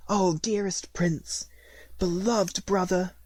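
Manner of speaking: high, breathy whisper of happy tears; tearful joy. A narrator's reading. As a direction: say it through tears of gladness, hushed and high. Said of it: "shed tears of gladness".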